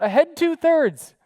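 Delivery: loud, high, slow command shout; yelled order. Retold or imitated. Captain yelling the order to the crew. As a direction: yell the order across the control room. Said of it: "So David yells out".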